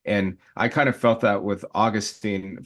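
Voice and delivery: normal talking voice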